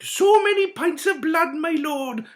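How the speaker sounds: high, fawning creepy voice; creepy knight. A narrator's reading. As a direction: simper at your lord. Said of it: "replied the knight".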